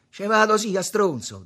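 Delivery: high, loud, scornful retort; increasingly disgusted. A narrator's reading. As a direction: throw it back in loud disgust. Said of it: "disse sempre più schifato Tommaso". (replica schifata)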